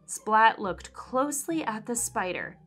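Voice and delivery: plain reading voice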